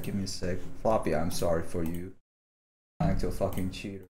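ordinary talk, nothing marked

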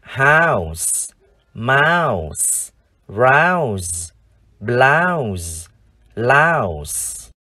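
"House, mouse, rouse, blouse, louse" each drawn out with pauses between; word-list drill. Shown as a demonstration. Teacher leads viewers re-reading five words, each long and separate. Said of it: "chúng ta cùng đọc lại năm từ này".

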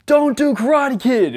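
high, loud, slow call from the back of the set; mock director shouting a note across the set. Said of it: "the director in the back, he says".

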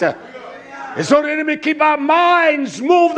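high, loud preaching shout; shouting preacher at full force; fervent preaching. Acted.

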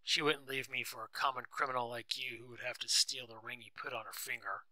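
ordinary delivery, nothing marked

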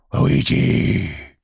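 loud, savage shout of the name; savage call. A narrator's reading. The voice calling the silent blind man a third time, savage shout.

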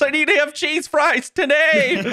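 high, slow, laughing mock revelation; mock dramatic revelation. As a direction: announce it like fate, laughing. Mock stars telling her.

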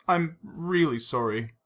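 slow, subdued, understated sorrow; muffled apology. A narrator's reading. Vesper with face in hands, muffled and sorry. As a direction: apologise slowly through mild upset. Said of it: "she said in a muffled voice".

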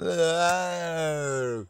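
high, drawn-out zombie wail; imitated zombie groan; zombie moan. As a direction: moan on like an undead crowd. Imitates zombies pressed against gates.

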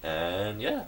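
ordinary talk, nothing marked

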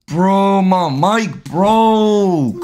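long, stretched-out wail of frustration; drawn-out complaint. Real, not acted. Streamer telling mom his mic broke, drawn-out complaint. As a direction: drag out each word in dismay.

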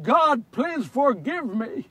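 slow, anguished plea; begging forgiveness. Acted. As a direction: beg God, drawing out each word. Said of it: "I begged God for forgiveness".

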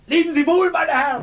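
high, loud mocking goodbye; shouted farewell. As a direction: call it back as you leap out. Courier escapes through window.

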